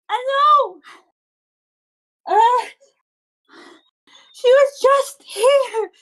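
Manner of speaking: high, drawn-out tearful wail; distraught little girl. Acted. Little girl tells boy her friend is lost, tearful wail. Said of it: "and she goes".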